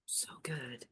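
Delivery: soft unvoiced whisper. Shown as a demonstration. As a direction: breathe the words out. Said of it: "And exhale".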